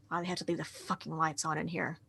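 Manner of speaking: low-volume, quick grumble; muttering. Acted. Game master voices the passing sheriff, muttering. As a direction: mutter it fast under your breath. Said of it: "you hear her muttering something about".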